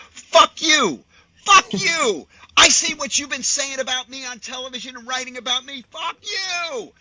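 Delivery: loud, high-pitched imitation of a furious actor yelling; angry retold outburst. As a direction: act out Costner blowing up, full-voiced and high. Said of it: "Is he really angry at me".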